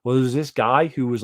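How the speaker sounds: normal talking voice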